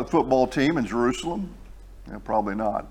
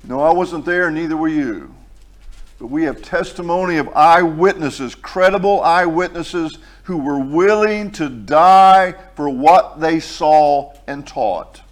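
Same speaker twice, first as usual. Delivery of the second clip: slow, louder preaching, every phrase pressed; full-force preaching; 3 semitones higher, 7 dB louder than usual. Acted. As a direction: proclaim it to the congregation, slowly. Emphatic preaching passage.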